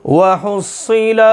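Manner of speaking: slow, drawn-out tajweed chant; Quran recitation. Acted. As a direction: recite each phrase long and measured. Quran recitation opening lesson.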